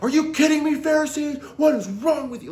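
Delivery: high, loud pretend outburst at the Pharisees; a teacher acting out the angry outburst Jesus never had; mock blow-up. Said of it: "this blow up moment where he's like".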